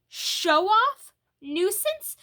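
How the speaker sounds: high, loud, shocked repeat of the insults; stunned disbelief. A narrator's reading. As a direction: echo the words in hurt disbelief.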